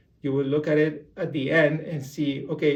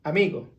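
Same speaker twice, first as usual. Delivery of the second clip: amigo flat on "a", raised on "mi", flat on "go"; raised middle syllable; faster than usual. Shown as a demonstration. Teacher shows the tune of amigo, voice raised on "mi". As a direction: lift the voice on the "mi" of amigo. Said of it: "raise your voice in mi".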